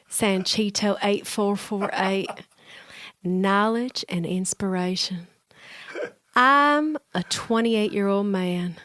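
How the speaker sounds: put-on slow, stretched Southern accent; put-on Southern accent with long vowels; Southern drawl. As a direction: read it in a lazy Southern drawl. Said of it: "give us a nice Southern drawl".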